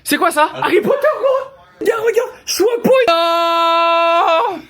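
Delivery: excited shouting. Real, not acted. Discovering the rental house.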